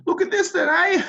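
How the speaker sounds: squeaky high fishman gloating; fishmen voice. Acted. Fishmen gloating over stolen loot, high squeak. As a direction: chirp it up high like a greedy fish creature. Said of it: "They're like".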